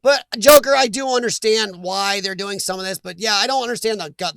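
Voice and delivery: normal talking voice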